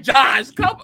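loud, high exasperated call. Retold or imitated. Viewer reacting to Josh's dramatics, loud exasperation. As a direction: call him out, half laughing.

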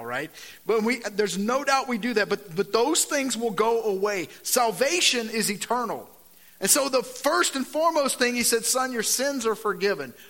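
loud, driving sermon delivery, pressing each point; loud preacher voice; full-force preaching. Acted. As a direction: preach it out to the whole room.

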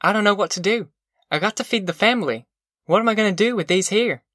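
helpless mother, pitched high; worried mother with no answer. A narrator's reading. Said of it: "Ma said helplessly".

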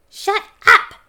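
high, loud, sharp hiss. A narrator's reading. Homunculus silencing the dwarf, hissing loudly. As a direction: hiss it forcefully at high pitch. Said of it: "hissed Twigleg".

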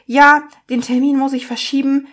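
pissed-off patient. Retold or imitated. Irritated patient at reception moving an appointment, mock pissed-off. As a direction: imitate someone openly annoyed at the desk. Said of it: "aber die Art und Weise waren so richtig pisst".

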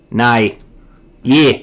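Aussie "nay" for no; one-word Aussie "Nay."; Australian accent. Pretend. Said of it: "singular syllable".

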